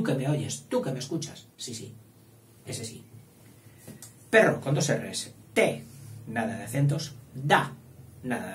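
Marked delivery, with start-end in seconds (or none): none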